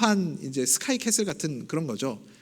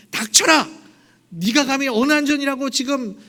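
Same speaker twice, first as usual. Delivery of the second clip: loud, much higher roar of contempt; furious bellow; 7 semitones higher, 5 dB louder than usual. Retold or imitated. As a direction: shout her down like an outraged nobleman. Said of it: "윽박 지르는 겁니다".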